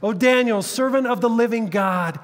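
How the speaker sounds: high, loud anguished cry; anguished calling out. Acted. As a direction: cry out desperately into the pit. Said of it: "calls out to Daniel in an anguished voice".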